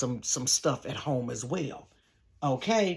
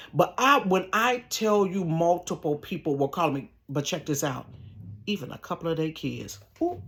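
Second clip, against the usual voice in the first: usual speaking voice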